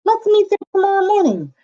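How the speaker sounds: a bright eager piggy voice; squeaky little pig making plans. A narrator's reading. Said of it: "said the little pig".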